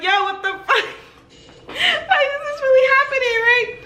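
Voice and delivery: high-pitched, giggly freak-out; reenacted hysterical amazement; laughing disbelief. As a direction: squeal it through laughter.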